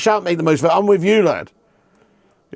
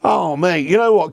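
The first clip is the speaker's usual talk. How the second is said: ordinary talk, nothing marked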